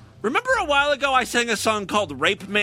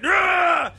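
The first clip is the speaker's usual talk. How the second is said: mock angry roar; 11 semitones higher, 4 dB louder than usual. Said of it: "he's angry".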